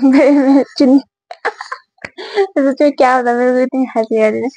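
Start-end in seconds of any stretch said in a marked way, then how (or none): 2.0-2.5 short high laugh. Real, not acted. Laughing remembering the first meeting.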